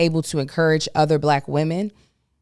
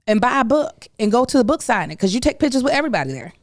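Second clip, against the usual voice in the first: high, fast, heated venting; getting riled up all over again; real irritation rising as she vents; worked-up rant; 8 semitones higher than usual. Real, not acted. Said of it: "just talking about it pissed me off again".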